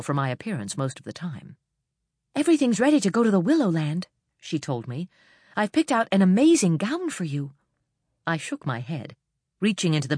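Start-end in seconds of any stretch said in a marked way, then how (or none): 2.3-4.1 eager. A narrator's reading. Eager servant Nia speaks. 5.6-7.5 eager. A narrator's reading. Eager servant Nia speaks.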